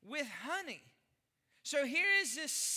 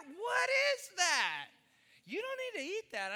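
mock disbelief; 6 semitones higher, 3 dB louder than usual. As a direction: stretch it out baffled. Mock bafflement at Peeps.

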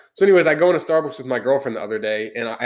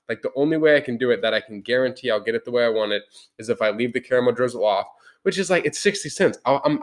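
ordinary talk, nothing marked

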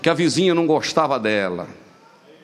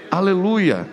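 usual speaking voice

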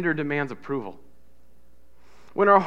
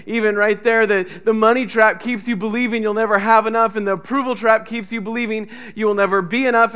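normal talking voice

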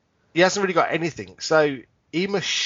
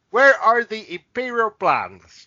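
slow, loud, higher Vader interrogation; menacing Vader; 5 semitones higher, 5 dB louder, much slower than usual. Pretend. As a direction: drag out a threatening demand.

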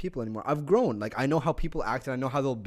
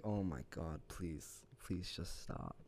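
low, hushed begging; soft, worn-down retold plea; weary pleading; 9 semitones lower, 16 dB quieter, slower than usual. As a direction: beg under your breath, fading almost to nothing. Retold pleading at endless talker.

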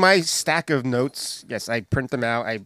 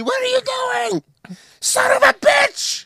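high, loud outraged yell; screaming at the TV; 3 dB louder than usual. Acted. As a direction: yell furiously at the TV in mock rage. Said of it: "Not screaming at the T V".

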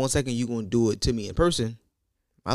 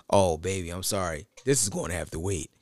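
soft, low sweet-talk; mock tender apology; 7 semitones lower, 6 dB quieter than usual. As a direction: let them down gently, voice lowered. Mock gentle apology for waiting.

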